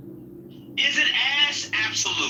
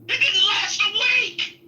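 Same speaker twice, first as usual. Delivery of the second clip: shouting punchline; 10 semitones higher than usual. Real, not acted. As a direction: blurt it out at full volume.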